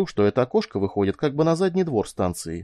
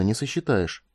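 ordinary delivery

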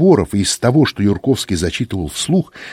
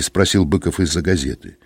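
plain reading voice

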